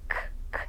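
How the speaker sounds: K, K as bare whispered puffs of air; teacher isolating the unvoiced K; voiceless k sound. Shown as a demonstration. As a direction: puff out K with no voice. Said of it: "Esse som não tem vibração nenhuma".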